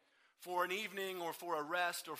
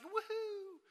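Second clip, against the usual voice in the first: mock cheer; 12 semitones higher, 4 dB quieter than usual. Mocking cheery version of story.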